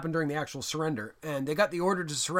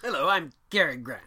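put-on Mid-Atlantic accent. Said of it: "Mid-Atlantic accent".

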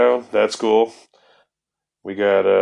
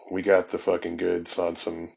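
ordinary talk, nothing marked

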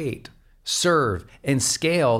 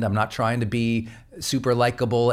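normal talking voice

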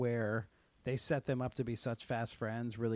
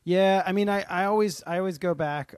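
loud; 7 semitones higher, 9 dB louder than usual. Real, not acted. Defending Carrie in a heated debate.

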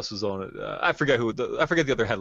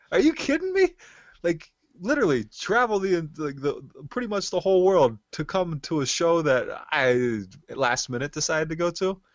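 high-pitched, incredulous exclaiming; retold amazement; 7 semitones higher than usual. Amazed he crossed the world for the show, high incredulous retelling. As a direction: marvel at it, starting very high and easing down.

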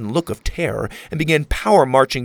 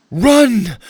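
loud, high warning yell; urgent shout; 11 semitones higher, 10 dB louder than usual. Acted. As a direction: yell it to send them running. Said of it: "Mora shouted".

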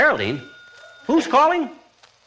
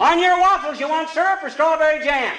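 raised voice across rooms; husband calling to wife from kitchen, raised voice; 10 semitones higher than usual. Acted. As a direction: shout the question to the bedroom. Calling to Molly in bedroom.

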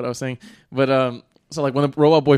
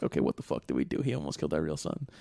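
hushed horror; 11 dB quieter than usual. Retold or imitated. Shocked parents after near drowning.

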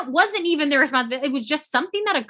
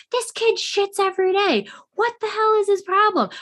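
much higher, fed-up parent voice; exasperated mock parent; 10 semitones higher than usual. Parent venting about a child.

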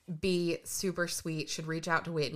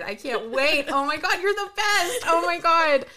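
excited gushing; 9 semitones higher, 6 dB louder than usual. Real, not acted. Telling her friend she's the best, gushing. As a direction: voice rising higher with each line. Overjoyed at gift.

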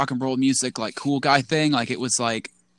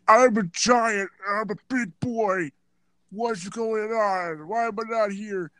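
high, slow dopey giant voice; mocking Big Show; 8 semitones higher, slower than usual. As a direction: play the whiny big guy, slowly and higher. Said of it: "just typical, like".

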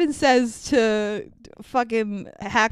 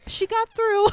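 much higher, strained mock assurance; imitated high reassurance; 11 semitones higher than usual. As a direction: imitate covering up bad news, pitched high. Retold reassurance to anxious husband.

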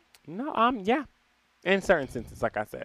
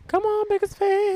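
high teasing voice; 12 semitones higher than usual. Real, not acted. Teasing hype for the biggest fan.

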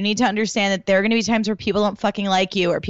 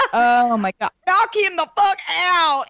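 put-on higher, slow Southern country accent with a laugh; Brittany's accent; 8 semitones higher, slower than usual. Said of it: "You're so good at Brittany's accent".

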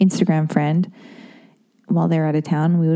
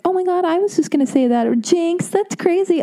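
gushing couple; 11 semitones higher than usual. Retold or imitated. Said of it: "we're like".

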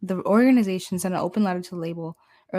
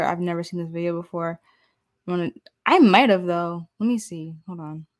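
normal talking voice

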